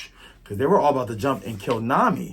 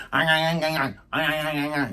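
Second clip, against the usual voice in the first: rapid cartoon chomping noises; repeated "ah" as a mock gnawing sound; Luffy; 4 semitones higher, faster than usual. Captured pirate gnawing away, imitated cartoon chomping. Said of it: "Luffy's like".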